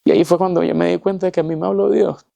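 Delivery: real high, tearful voice; choked with emotion. Real, not acted. Survivor testifying that God spoke to him, high choked-up voice. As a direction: push the words out through rising tears.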